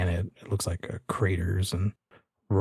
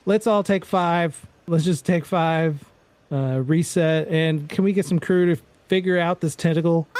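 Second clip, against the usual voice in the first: raised, brisk call to the crew; assistant director; 8 semitones higher than usual. Acted. AD calling a break to the whole crew, raised voice. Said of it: "the A D comes in".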